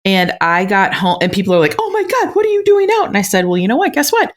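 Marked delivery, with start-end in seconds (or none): 1.8-3.1 high, shrill disbelief from the neighbours; mock shocked. Retelling neighbours gasping at her driving in a blizzard, mock shocked. 3.5-4.3 playful boast. Retold or imitated. Her retold breezy reply.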